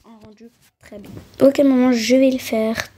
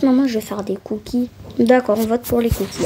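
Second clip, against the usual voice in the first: ordinary talk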